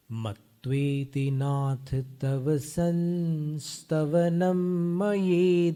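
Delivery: very slow, drawn-out recitation; slow reading. Shown as a demonstration. Teacher reading the verse slowly for students. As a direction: stretch every syllable. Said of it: "मंद गति से पढ़ते हैं".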